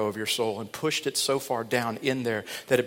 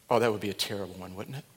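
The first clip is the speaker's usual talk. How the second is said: ordinary talk, nothing marked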